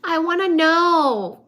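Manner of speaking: playful frustrated wail; mock whining. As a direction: whine it out, drawn out and hammed-up. Frustrated by unanswered cosmic questions.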